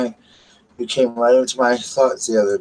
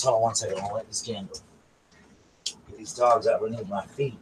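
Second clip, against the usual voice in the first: ordinary talk, nothing marked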